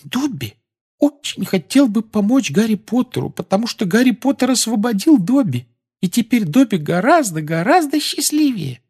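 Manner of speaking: very high earnest squeak; heartfelt elf squeak. Acted. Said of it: "сказал с чувством".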